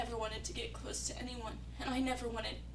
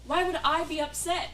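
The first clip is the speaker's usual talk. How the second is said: loud, sharp denial thrown as a question; defensive outburst; 9 dB louder than usual. Acted. Hurt lover denying she is upset, loud defensive snap.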